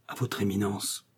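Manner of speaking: soft, hushed repetition to himself; stunned murmur. A narrator's reading. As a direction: murmur it under your breath. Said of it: "murmura Bonacieux".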